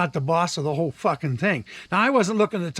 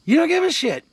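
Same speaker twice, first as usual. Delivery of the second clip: much higher and louder at the peak; retold angry outburst; 10 semitones higher, 4 dB louder than usual. Caller telling off the agent, high and heated. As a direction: fling the accusation, boiling over.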